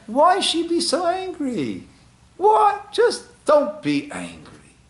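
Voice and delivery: high, squeaky mock astonishment; a hammed-up incredulous squeak; mock disbelief. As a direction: pretend to be shocked someone's angry. Mocking the plea's logic.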